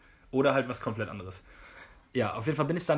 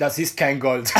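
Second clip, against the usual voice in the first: loud, curt mock verdict; 6 dB louder, slower than usual. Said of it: "fällt mir fast ins Wort". (nachgeahmte Juwelierin, laut dazwischengeworfen)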